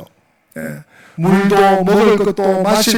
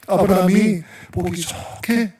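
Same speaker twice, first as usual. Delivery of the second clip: ordinary delivery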